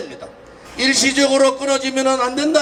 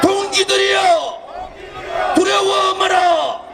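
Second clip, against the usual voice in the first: roaring rallying speech; 5 semitones higher, slower than usual. Real, not acted. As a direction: bellow each line of the slogan. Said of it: "불렀던 구호를 ... 다시 하겠습니다".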